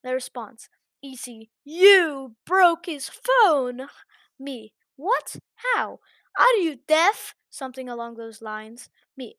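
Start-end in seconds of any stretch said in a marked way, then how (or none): 1.0-3.9 slow, loud, high accusing woman; accusing chaperone. Acted. Chaperone blaming the narrator for the phone, slow loud accusation. 5.0-7.3 high, loud disbelieving outburst; shocked retort. Acted. Narrator reacting to the accusation, shocked and loud.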